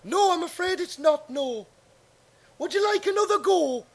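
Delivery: bright TV presenter welcoming the audience, pitched high; Paul Daniels impression. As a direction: showman host on a Saturday night quiz. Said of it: "I'm Paul Daniels".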